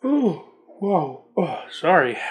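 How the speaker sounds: words stretched by a yawn. Real, not acted. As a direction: talk through a real yawn. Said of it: "I'm yawning here".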